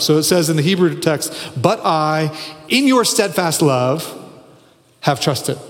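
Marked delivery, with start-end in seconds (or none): 1.6-5.6 "in your steadfast love" slow and raised, set off by a pause; fronted phrase emphasis. Shown as a demonstration. Preacher reading the Hebrew word order, emphatic. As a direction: dwell on "in your steadfast love", then drop on "have trusted". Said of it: "forward for emphasis".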